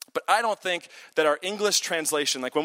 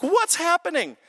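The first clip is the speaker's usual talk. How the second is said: imagined bewilderment, pitched high; high, mock-bewildered question; Moses, bewildered; 10 semitones higher than usual. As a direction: voice a baffled inner thought, high-pitched. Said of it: "what's going through Moses' mind".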